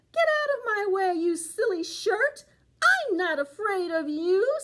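put-on high, crying-edged old lady voice; an old woman shooing things off, pitched high; spunky granny telling the pants off; defiant little old lady. Old lady defies the shirt.

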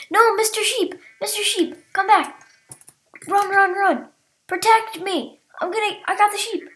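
panicked chase, shouting at the sheep; being chased. Real, not acted. Chasing a sheep in game.

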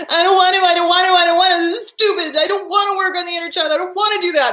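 high, whiny mock tantrum; temper tantrum. Client refusing inner child work, imitated whiny tantrum. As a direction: throw a childish fit, words tumbling out. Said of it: "have literally a temper tantrum".

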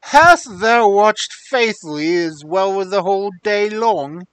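loud, stern king questioning. Acted. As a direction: demand it like a king. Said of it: "whose accent changed".

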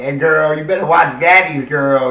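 loud, high sassy call-out; playful call-out. Pretend. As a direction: call her out loudly like a bestie. Mock scolding about watching Baddies.

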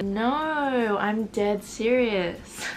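slow, drawn-out reply; mock insistence. Girl assures her the job is real, mock slow insistence. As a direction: drag out each word as the insistent texter. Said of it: "And she said".

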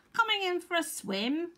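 high-pitched duck's invitation; quacking duck. A narrator's reading. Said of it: "quacked some ducks".

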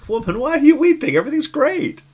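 high, bright-voiced breezy reading that misses the grief; mock cheerful reading. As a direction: sing it out as if nothing is wrong. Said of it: "it was sort of read that way".

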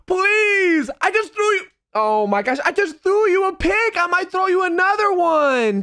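high pleading yell, voice cracking; real strained, cracking protest. Real, not acted. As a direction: beg at a high pitch until the voice cracks. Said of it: "I did have another voice crack".

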